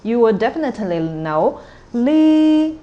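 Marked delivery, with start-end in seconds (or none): 1.9-2.8 "li" high, level and drawn out; stressed syllable. Shown as a demonstration. Teacher showing learners a stressed syllable, high "li". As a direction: hold "li" up on one high note. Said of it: "is stressed".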